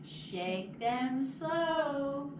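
ordinary delivery, nothing marked

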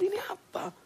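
whispered, near-crying wonder, retold; a hushed retold cry of amazement; bewildered whisper. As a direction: breathe it out in awe.

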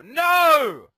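high sharp shout of no; real shout. Real, not acted. Player losing the fight shouts at the screen, loud and high. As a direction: yell it out in protest.

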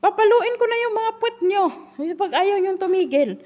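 put-on high, loud scolding yell at the kids; angry shout. Nanny shouting at two crying kids at dawn, angry yell. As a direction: snap at them like a fed-up nanny at 2 am. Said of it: "sinigawan ko sila".

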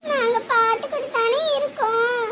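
high, small, tearful voice; crying. Acted. Child distressed at seeing aunt scolded, crying. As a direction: plead softly through tears, with pauses. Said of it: "நீங்க ஏன் சாமி அழுதுட்டு இருக்கீங்க".

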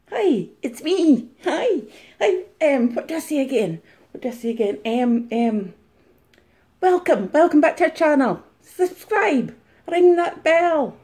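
high, sing-out mock vlogger greeting; mock reseller. Impersonator mocks a reseller welcoming viewers, high-pitched parody. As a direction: send up a gushing channel intro, pitched high. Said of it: "taking the mic out of a reseller".

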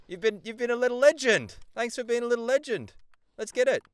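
high, rousing praise; excited hype. Real, not acted. Player thanking teammate as a little legend, excited hype. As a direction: pump up a teammate with high energy.

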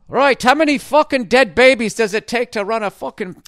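high, loud joke-teller voice with a put-on Irish lilt; mock Irish joke.

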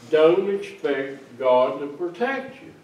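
ordinary delivery

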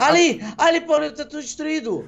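high shocked calling out; shocked shout. Pretend. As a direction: cry out at the ruins. Shocked at destroyed city. (grito chocado, agudo)

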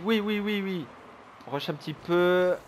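ordinary delivery

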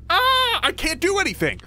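very high scream into a helpless complaint; exasperated wail. Real, not acted. Player who can't do anything, exasperated wail.

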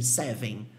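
"seven" ending in nasal "vein"; seven errado. Shown as a demonstration. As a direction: drop the final N of "seven". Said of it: "como se fosse assim". ("seven" como "sévem")

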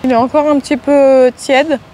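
chewing between words; eating while talking; mouth partly full. Real, not acted. Seen 0: Bit onigiri just before; mouth moves chewing-like while speaking, food held.

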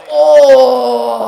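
usual speaking voice

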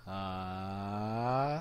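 long "uh" sliding upward and swelling; rising vocal slide. Shown as a demonstration. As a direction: test the top of the range. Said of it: "I'm gonna try something".

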